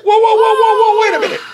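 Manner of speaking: high, loud, re-enacted alarm; stunned protest. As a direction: call a frantic halt, playfully overdone. Acts out own stunned reaction.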